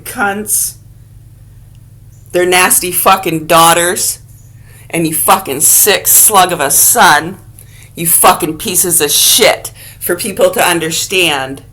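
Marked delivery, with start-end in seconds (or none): none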